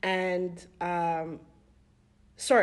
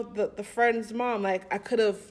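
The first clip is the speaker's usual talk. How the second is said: ordinary talk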